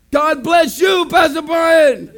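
high, loud drawn-out holler; imitated street greeting. Blessed locals calling back to the pastor, shouted greeting.

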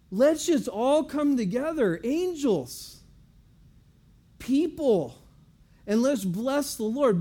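high, loud call to join in; fired up. Acted. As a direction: summon the whole crowd excitedly. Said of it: "now he's so fired up".

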